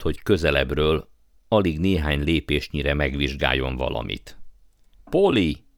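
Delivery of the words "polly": high-pitched call to a friend; calling back. A narrator's reading. Boy calling back to the girl, excited shout. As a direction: call out the name, voice jumping up. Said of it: "Kiáltott vissza".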